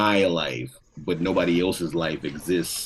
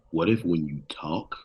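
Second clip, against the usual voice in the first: stoner voice; 3 semitones lower, 7 dB quieter than usual. Pretend. Deep stoner musing.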